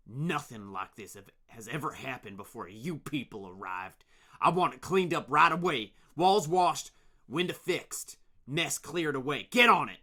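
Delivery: loud barked commands; angry orders. Acted. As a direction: order them around furiously. Angry leader giving orders.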